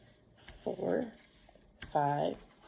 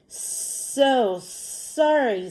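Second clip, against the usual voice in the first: very slow, higher, with long hissed S; snake voice; 5 semitones higher, much slower than usual. Acted. Snake apologizing to crow, drawn-out hissing. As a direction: stretch each S like a snake. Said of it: "they want you to say it like a snake".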